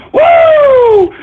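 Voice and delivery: loud high-pitched woo; high whoop. Real, not acted. Streamer celebrating angry trolls with a loud whoop. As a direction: let out a triumphant whoop.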